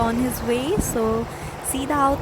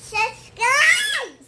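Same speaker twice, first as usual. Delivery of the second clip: Subscribe yelled high and loud; shouted subscribe; 12 semitones higher, 7 dB louder than usual. Real, not acted. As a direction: belt out subscribe. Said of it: "मामा को प्यार कैसे करते हैं बता दो तो।". (Subscribe ऊँची और ज़ोरदार आवाज़ में चिल्लाकर)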